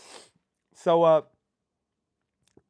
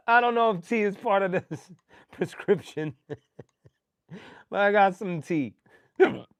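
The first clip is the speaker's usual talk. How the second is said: normal talking voice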